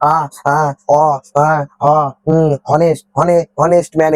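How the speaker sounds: halting sound-by-sound reading attempt; false starts and groping vowels, with pauses between; struggling reader. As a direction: imitate someone stumbling through a hard word. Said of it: "অনেকে দেখি এটা এরকম ভাবে পড়ে".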